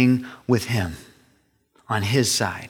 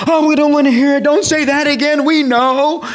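much higher, louder whining protest; mock whiny children, high and loud; whiny kids; 13 semitones higher, 6 dB louder than usual. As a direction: whine like kids who don't want to hear it. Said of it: "they act the same way".

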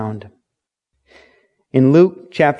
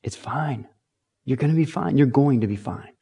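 calm reassurance; 7 dB quieter than usual. Retold or imitated. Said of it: "Jesus is like".

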